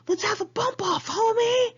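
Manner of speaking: hamming up her friend yelling out the window; mock yell. Dealer friend yelling at another car, mock yell. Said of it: "she would roll down the window and yell".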